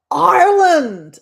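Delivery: loud, high, drawn-out cry of the country; excited announcement. Real, not acted. Host revealing where they landed, loud excited call. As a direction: belt it out, stretching the first syllable.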